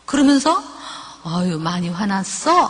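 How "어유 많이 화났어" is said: low, slow sympathetic coo; soothing empathetic mother. Shown as a demonstration. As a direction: comfort an upset child, drawing out the words. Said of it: "그 마음을 잘 들어주면".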